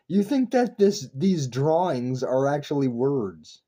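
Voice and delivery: ordinary talk, nothing marked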